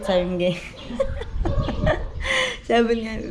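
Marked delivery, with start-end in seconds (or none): 0.6-2.6 high laugh. Real, not acted. Vlogger laughing while retelling the cake story, high laugh. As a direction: burst of high-pitched laughter.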